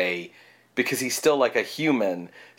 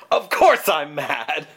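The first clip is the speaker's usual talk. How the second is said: slow, higher gleeful mad scientist proclamation; mad scientist line; 5 semitones higher, 4 dB louder, slower than usual. Retold or imitated. Quoting Lionel Atwill's villain, slow proud mad scientist. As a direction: proudly declare it, mad doctor style. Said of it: "he gets to say the line".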